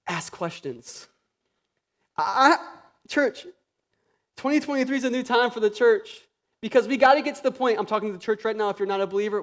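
usual speaking voice

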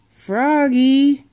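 loud, high call across the house. A narrator's reading. Father calling his son to the hospital, a loud high call. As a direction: call him from the other room. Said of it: "called his father".

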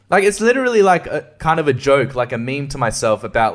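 ordinary talk

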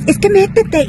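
high-pitched mock yell; grito imitado. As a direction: mimic the insistent woman's shouting. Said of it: "gritándome". (grito fingido y agudo)

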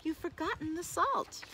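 high, mock-sorrowful; sad reproach. A narrator's reading. Boy telling the staggering wolf, high mock-sad reproach. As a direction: break the bad news with feigned pity. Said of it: "shaking his head sadly".

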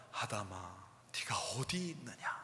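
soft, low whispered call; God gently calling Adam, soft whisper; gentle calling. Retold or imitated. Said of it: "이렇게 얘기하는 게 아니라".

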